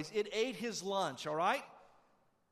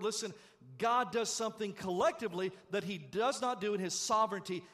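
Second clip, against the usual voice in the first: louder, building preacher's cadence; preaching; 5 semitones higher, 5 dB louder than usual. Acted. Pastor urging the congregation toward corporate worship, building preaching. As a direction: rise into the sermon's climax.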